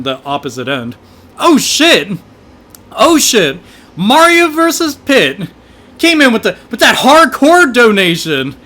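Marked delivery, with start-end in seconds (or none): 1.4-8.6 loud, high hype at a donation; excited shout. Real, not acted. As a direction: yell it out, thrilled by the money. Streamer reacts to big donation.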